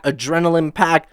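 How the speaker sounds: ordinary talk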